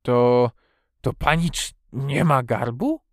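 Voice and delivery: halting, slow hoarse question; stunned old man's croak. A narrator's reading. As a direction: ask it hoarsely, stumbling on the first word. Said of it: "wyrzekł ochryple".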